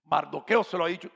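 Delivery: normal talking voice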